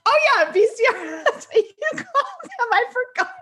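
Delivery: high-pitched giggly words between laughs; laughing. Real, not acted. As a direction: laugh through it at your own mix-up. Laughing about calling it VCR.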